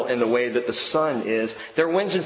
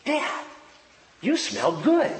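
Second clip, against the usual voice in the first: much higher, lightly voiced little girl; little daughter's voice; 10 semitones higher than usual. Daughter telling her dad he smells good, higher little-girl voice. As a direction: play the young daughter in a higher voice. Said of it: "and she goes".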